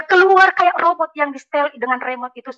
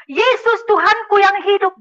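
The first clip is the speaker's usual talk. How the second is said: high, slow proclamation; imitated praise; 8 semitones higher, 3 dB louder, slower than usual. Father suddenly confesses Jesus, imitated cry of praise. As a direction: draw out a high-pitched confession of faith. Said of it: "Papa saya begini".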